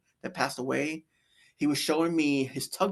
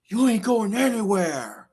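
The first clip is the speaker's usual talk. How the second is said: mean; 7 semitones higher, 5 dB louder than usual. Retold or imitated. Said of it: "Basically with mean, just mean".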